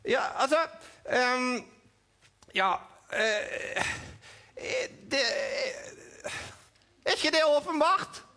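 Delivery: high puppet voice, mildly indignant; puppet Edvin. Acted. Puppet Edvin explaining himself to the host, high voice. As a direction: play the puppet, a touch put out.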